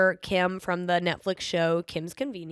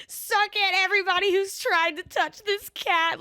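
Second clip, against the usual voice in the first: gloating; 11 semitones higher than usual. Retold or imitated. Gloating after taming the cat.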